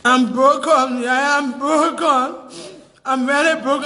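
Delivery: broken, drawn-out lament; slow down as if worn out by crying; real tearful distress. Real, not acted. Mother live online over losing her son, tearful. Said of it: "they will know why I'm crying".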